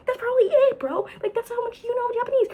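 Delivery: high squeaky exasperation; squeaky emphasis. Real, not acted. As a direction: squeak it out mockingly.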